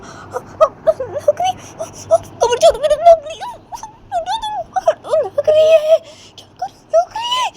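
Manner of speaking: very high, pained squeal; Uma in pain. Acted. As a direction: whimper and plead to be let go. (दर्द से कराहती, बहुत ऊँची आवाज़)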